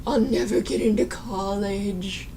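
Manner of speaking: hammed-up hopeless moan; mock depressed.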